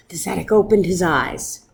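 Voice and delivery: ordinary delivery, nothing marked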